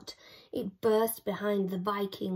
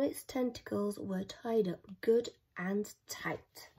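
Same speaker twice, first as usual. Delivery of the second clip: whispered, softer ending; 2 semitones lower, 7 dB quieter than usual. A narrator's reading. Reading to children, whispering the neat ending. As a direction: drop to a whisper. Tying up the squid.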